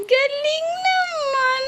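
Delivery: high, slow praise breaking into laughter; laughing sing-song praise. Real, not acted. Parent praising the toddler's eating, laughing and high. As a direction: coo it way up high, giggling.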